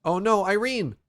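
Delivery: loud high worried cry; alarmed shout. Real, not acted. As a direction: call out in alarm. Companion in danger.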